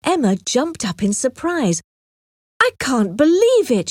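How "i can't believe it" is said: higher and louder disbelief; surprised cry. A narrator's reading. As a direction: blurt it out in shock. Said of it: "she cried".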